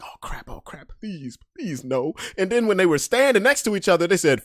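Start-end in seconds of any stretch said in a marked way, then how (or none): 0.0-2.1 unvoiced pleading whisper; much softer whispered please no; whispered dread. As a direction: beg under your breath. Dreading his friend gets knocked out.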